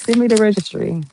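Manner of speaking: ordinary talk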